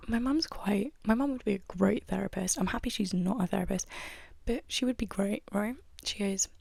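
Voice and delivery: ordinary delivery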